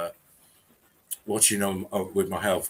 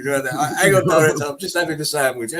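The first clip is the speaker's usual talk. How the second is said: mock actor; 8 semitones higher, 7 dB louder than usual. Plays an actor answering the director.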